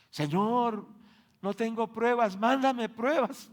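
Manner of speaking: high, loud, slow whiny pleading; mock crying plea. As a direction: imitate a tearful sister begging for trials. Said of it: "y lloraba y decía". (súplica llorosa fingida, aguda y lenta)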